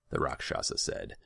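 ordinary delivery

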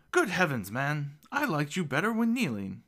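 high, loud feigned shock; mock startled cry. A narrator's reading. Gnome pretends to be startled by lord's height, crying out. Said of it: "Fedar cries out, pretending to be startled".

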